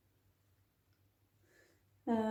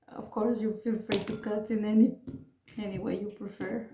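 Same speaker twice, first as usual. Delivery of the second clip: ordinary delivery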